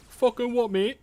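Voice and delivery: high, punchy Essex geezer challenge; mock Essex bloke snapping back; Essex accent. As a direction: play it as an Essex lad squaring up. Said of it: "That's more like Essex".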